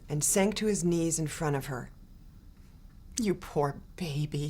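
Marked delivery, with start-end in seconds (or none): 3.2-4.5 soft whisper, lower; hushed comforting whisper. A narrator's reading. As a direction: breathe it tenderly to a crying child. Teacher comforts a crying girl.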